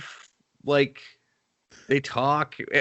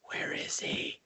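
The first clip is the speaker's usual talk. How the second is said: whispered, anxious aside; worried limo driver whispering to himself; whispered worry; 16 dB quieter, slower than usual. Retold or imitated. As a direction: hiss it under your breath while looking around.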